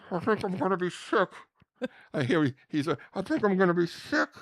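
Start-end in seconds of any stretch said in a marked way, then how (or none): 0.1-1.3 high, loud queasy moan; queasy costumed Elmo. Retold or imitated. Man in Elmo suit about to be sick, queasy wail. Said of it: "I look over at Eugene, who's playing, uh, Elmo". 3.1-4.3 high, loud queasy moan; queasy costumed Elmo. Retold or imitated. Man in Elmo suit about to be sick, queasy wail. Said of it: "He wasn't kidding around".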